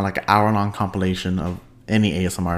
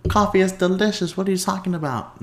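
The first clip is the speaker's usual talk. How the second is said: high-pitched mock disbelief; scandalized coffee fan, mocking; incredulous objector; 10 semitones higher than usual. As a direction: imitate an outraged coffee lover, pitched way up. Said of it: "people are gonna be like".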